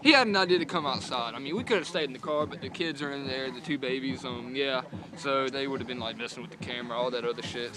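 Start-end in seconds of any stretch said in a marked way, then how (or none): none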